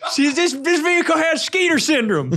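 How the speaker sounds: high, far-off mechanic voice; derby guy voice. Retold or imitated. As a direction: announce it like a pit mechanic. Continuing the derby voice.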